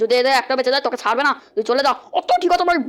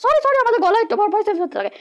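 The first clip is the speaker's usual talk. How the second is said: higher cartoon demon apologising; squeaky devil voice; 8 semitones higher than usual. Devil apologises for the voice swap, squeaky. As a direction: squeak out a sorry. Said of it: "আমার গলা change করে দিয়েছো".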